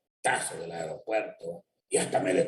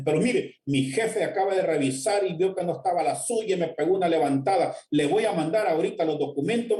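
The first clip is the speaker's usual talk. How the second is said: high, hurried apology in a flustered woman's voice; desperate young woman; 9 semitones higher than usual. Retold or imitated. Purchasing clerk apologizing to the engineer, high and flustered. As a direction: play the panicked buyer owning her mistake. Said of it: "aquella muchacha desesperada me dice".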